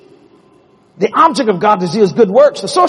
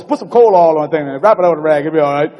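put-on old grandpa; 4 semitones lower, faster than usual. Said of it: "My grandpa says".

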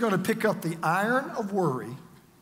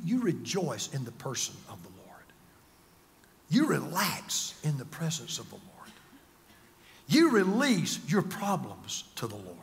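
intimate whisper; 4 semitones lower than usual. Acted. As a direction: pull them close and whisper. Preacher drops to a whisper.

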